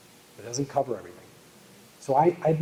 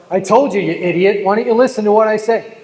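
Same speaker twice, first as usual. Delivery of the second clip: loud, higher scolding father yelling at his son; angry dad; 6 semitones higher, 6 dB louder than usual. Retold or imitated. Dad yelling at his son over knives, loud and angry. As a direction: bark it at your foolish son like an annoyed father.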